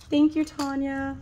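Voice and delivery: high, slow, drawn-out thanks; gushing thanks. Real, not acted. Thanking a friend for cute gifts, gushing. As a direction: draw the thanks out in a high, delighted voice.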